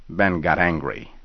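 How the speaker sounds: ordinary delivery, nothing marked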